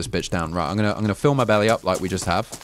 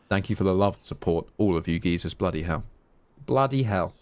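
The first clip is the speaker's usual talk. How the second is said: normal talking voice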